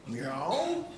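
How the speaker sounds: lightly voiced slow De Niro meow; drawn-out mock tough meow; Robert De Niro cat. Comic showing his cat's De Niro meow, light slow impression. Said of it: "meowed like Robert De Niro in Taxi Driver".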